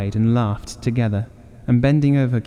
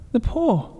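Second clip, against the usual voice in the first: sudden very high cry; wild cry; 14 semitones higher than usual. Acted. Wife wakes husband with a wild high cry. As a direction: shriek it out in the dark. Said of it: "she cried wildly".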